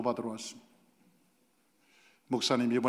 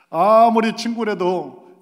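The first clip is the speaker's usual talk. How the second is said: emphatic; 6 semitones higher, 10 dB louder, slower than usual. Real, not acted. Preacher's emphatic punchline.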